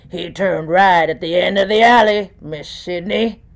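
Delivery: lightly voiced old bum, timbre changed; a hint of a raspy street bum calling out; bum in the alley. A narrator's reading. Bum voiced in narrated story.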